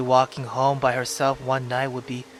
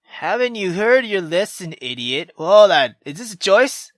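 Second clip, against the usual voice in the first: much higher and louder harsh scolding; furious mother snapping at her daughter; 7 semitones higher, 10 dB louder than usual. Acted. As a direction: berate the girl like an angry mother.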